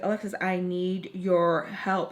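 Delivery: slow, patronising, spelled-out plea; slow it down as if to a child. Retold or imitated. Teacher pressing the student, slow and condescending. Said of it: "talk slower to, I guess, dumb it down".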